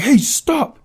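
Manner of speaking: sharp high shouted stop; angry neighbour yelling at the fleeing kid; adult yelling. Retold or imitated. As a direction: yell it at a kid caught on your porch. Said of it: "an adult yelling at me".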